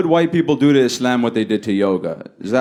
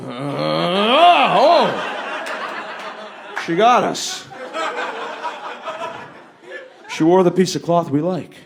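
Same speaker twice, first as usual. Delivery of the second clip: a lightly voiced baffled bigot; mildly mock relieved stranger; confused bigot; 7 semitones higher, much slower than usual. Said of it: "People are gonna see my mom walking down the street like".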